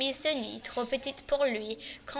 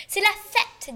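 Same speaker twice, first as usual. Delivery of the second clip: loud, much higher, bursting with joy; joyful; 8 semitones higher, 10 dB louder, slower than usual. A narrator's reading. Boustru joyfully greets his astonished servants, high and loud. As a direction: announce the party with delight. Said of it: "dit joyeusement Boustru".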